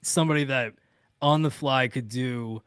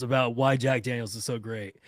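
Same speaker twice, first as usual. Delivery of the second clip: ordinary talk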